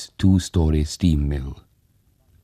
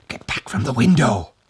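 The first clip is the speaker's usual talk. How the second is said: higher, tight, choked cry; strangled shout; 6 semitones higher than usual. Acted. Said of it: "shouted in a strangled voice".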